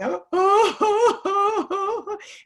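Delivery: a burst of high laughter; rueful high laugh. Real, not acted. Laughing at own memory.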